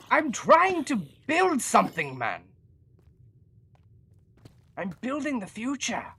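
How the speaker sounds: put-on proud old-timey visionary; 1800s inventor voice. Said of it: "I'm stuck in the accent".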